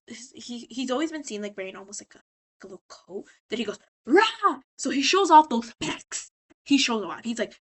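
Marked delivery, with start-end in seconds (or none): 4.1-4.6 loud, high burst of a roar; sudden mock-monster "rah"; imitated roar. Valentino showing off his wings, mock roar.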